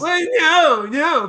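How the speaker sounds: high, loud "no, no"; loud flustered protest; exasperated objection. Real, not acted. Objects in the bickering.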